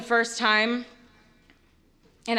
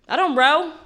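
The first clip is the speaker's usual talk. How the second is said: God repeating the refusal higher and louder; insistent God; 7 semitones higher, 6 dB louder than usual. Retold or imitated. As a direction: insist on "I don't row", punching it.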